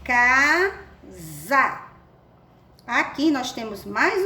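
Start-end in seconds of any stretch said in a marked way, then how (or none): 0.0-1.8 "Casa" esticado em "caaa... za"; leitura silabada. Shown as a demonstration. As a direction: leia "Casa" sílaba por sílaba, com Z vibrante. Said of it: "temos a sílaba K mais za". ("Casa" silabado, com som de Z)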